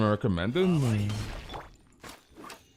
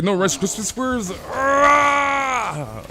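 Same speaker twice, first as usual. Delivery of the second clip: much higher, louder hammed-up shriek; mock outrage; 7 dB louder, much slower than usual. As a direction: wail like the snacks are gone forever. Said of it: "He's like".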